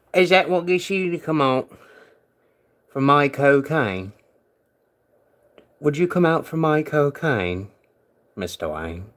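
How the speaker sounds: put-on playful British accent, lightly done; mock British accent. Tempting pet Theo out in a mock British accent. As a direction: tease in a cheeky British voice. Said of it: "bust out the British voice again".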